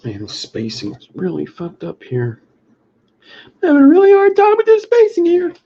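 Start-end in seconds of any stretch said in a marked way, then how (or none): 3.4-5.5 much higher, louder exasperated whine; frustrated high outburst. Pretend. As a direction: vent it in a squeaky loud wail. Sudden high loud frustrated outburst.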